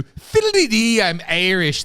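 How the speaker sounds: high, loud, slow stage-Irish lilt; bad Irish accent. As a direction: belt out a badly faked Irish accent. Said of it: "I can't do an Irish accent".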